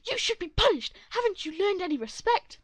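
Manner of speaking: high, loud furious shout; angry mother. Acted. Angry mother confronting the teen, shouting. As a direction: scream it at him like an outraged mom. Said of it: "angry mother scolds".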